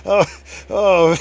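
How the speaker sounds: loud high laughter with gasped "oh"; real laughing fit. Real, not acted. Storyteller amused by the blind man's comeback, real laughing fit. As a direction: crack up, catch an "oh", laugh on. Cracks up at his own story.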